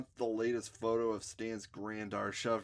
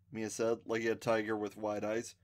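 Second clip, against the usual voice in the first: usual speaking voice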